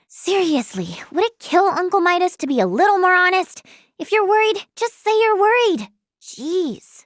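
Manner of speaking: exasperated rise, then a deflated jeez. Acted. Niece grumbling about her uncle's dishonesty, exasperated. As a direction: complain with rising exasperation.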